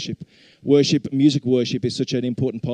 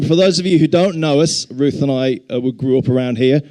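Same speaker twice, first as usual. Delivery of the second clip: ordinary delivery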